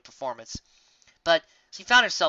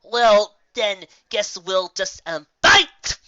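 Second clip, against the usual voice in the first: hesitant and slow, then a sudden high loud cry; stalling nervously, then blurting out; nervous gulp into fight; 6 semitones higher, 10 dB louder, much slower than usual. Acted. Griffin cornered by Raindrops, stammers then blurts. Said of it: "She gulped".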